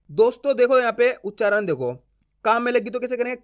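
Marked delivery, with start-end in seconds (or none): none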